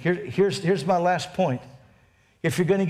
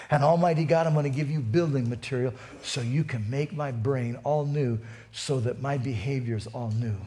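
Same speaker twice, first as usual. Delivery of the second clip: earnest prayer; 4 semitones lower than usual. Acted. Pastor modelling a surrender of his thoughts to God, earnest prayer. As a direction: pray it low and intimately to God.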